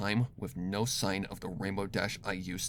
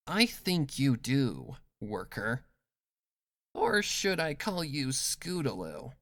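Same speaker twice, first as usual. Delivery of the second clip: higher, cold, toying interrogator; Rainbow Dash, menacing; 6 semitones higher, 4 dB louder than usual. Acted.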